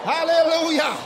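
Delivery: high, prolonged exultant cry; revival-style shouts and whoops; shouting praise. Shown as a demonstration. Demonstrates shouting glory in cell meeting.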